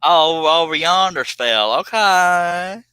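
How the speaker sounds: hammed-up Southern hillbilly accent. Host clowns for the viewers in a Southern hillbilly accent. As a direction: ham up a backwoods Southern drawl. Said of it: "Sound just like Honey Boo Boo".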